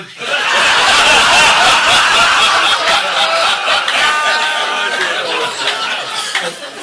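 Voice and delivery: long, high, loud fit of laughter; roaring laugh. Real, not acted. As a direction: let the laugh run away with you. Big laugh after punchline.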